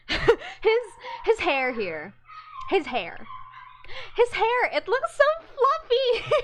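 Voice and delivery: high, loud, giggly gushing; delighted gushing. Real, not acted. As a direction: squeal with delight over the fluffy hair. Gushing, laughing at fluffy hair.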